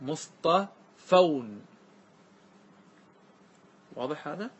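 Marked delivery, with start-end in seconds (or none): 0.0-1.6 مصطفون drawn out for students; teacher's slow model مصطفون; very slow model word. Shown as a demonstration. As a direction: lay out مصطفون slowly so they copy it. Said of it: "كيف تجمع مصطفى".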